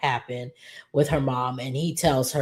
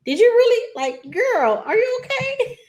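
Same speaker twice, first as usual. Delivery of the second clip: much higher, laughing through a mock shriek; mock shrieking disbelief; 4 dB louder than usual. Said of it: "And Vanessa was just like".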